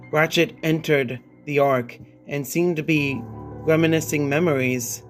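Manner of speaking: ordinary delivery, nothing marked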